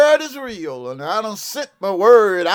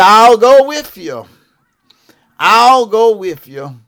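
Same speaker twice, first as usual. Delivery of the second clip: booming voice of God; 7 dB louder than usual. Retold or imitated. God's promise voiced in preaching.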